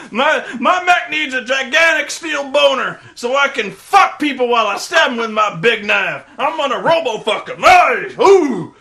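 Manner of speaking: high, loud macho whooping; building to a whooping laugh; hammed-up colonel shout. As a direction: bellow like a deranged Marine. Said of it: "He's like".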